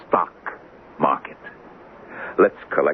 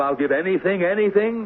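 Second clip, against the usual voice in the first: mild mock yell; 9 semitones higher than usual. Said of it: "Didn't you yell".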